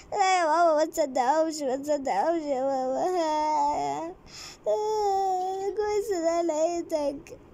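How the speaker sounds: high, tearful plea to dad; crying relief. Acted. Rescued girl sobbing to her father not to believe them. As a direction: sob it out to your father.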